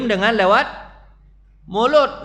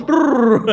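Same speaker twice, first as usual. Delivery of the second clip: a loud, higher shivering brrr as he runs; shivery brrr sound; 5 semitones higher, 6 dB louder than usual. Retold or imitated. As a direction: shudder out a cold brrr. Lari kabur dari ayah. (suara brrr menggigil, keras)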